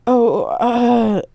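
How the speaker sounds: high, flustered stammer. Acted. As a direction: caught out, fumble for words. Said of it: "I stammered".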